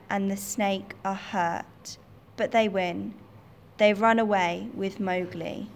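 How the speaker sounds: plain reading voice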